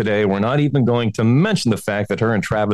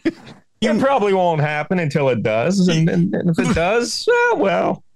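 higher, slow, carefree mock-ad voice; mock breezy; 9 semitones higher than usual. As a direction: brush off death like a cheery ad. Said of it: "they make it sound like".

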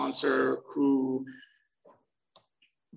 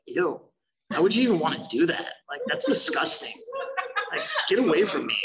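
disgusted mock rant; 5 semitones higher than usual. Mocking people taking chips.

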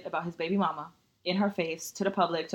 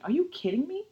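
outraged disbelief; 8 semitones higher than usual. Real, not acted. Outrage over verdict.

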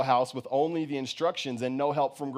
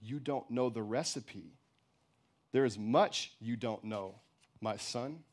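softer, lower motherly voice; calm, cutting; 3 semitones lower, 7 dB quieter than usual. A narrator's reading. Mother telling her son his ignorance, calm but cutting. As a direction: lay it down quietly, without irritation. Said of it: "No irritation, but the words still cut".

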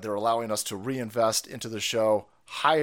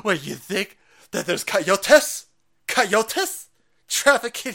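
mock incredulous critic; 6 semitones higher, 6 dB louder, much slower than usual. Mocking critics.

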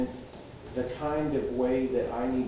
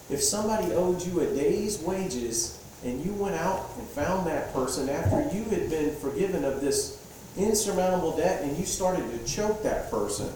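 usual speaking voice

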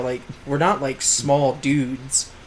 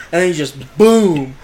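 normal talking voice

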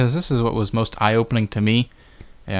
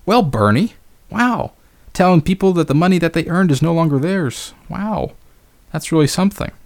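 sarcastic; 5 semitones higher than usual. Real, not acted. Mocking reaction to the quote.

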